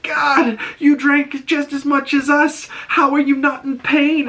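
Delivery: high-pitched whiny complaint; bitching and moaning. Retold or imitated. Hungover Adebayo complaining to Harcourt, high mock moaning. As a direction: moan it like a hungover grumbler. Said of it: "Adebayo is bitching and moaning".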